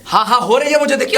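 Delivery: heated, high, forceful admission; angry outburst. Acted. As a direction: blurt it out, fed up. Man snaps at her about touching.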